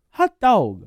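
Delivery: high cartoon plumber cry; Mario voice. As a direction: squeak it like Mario. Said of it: "the other one where he goes".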